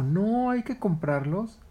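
high, slow naive monkey. Acted. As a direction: state it innocently. Monkey replies.

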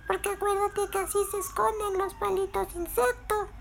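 very high squeaky insect voice; strange insect character. Acted. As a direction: explain in a tiny, odd, high voice. Voz de Berilio. (vocecita extraña y aguda de insecto)

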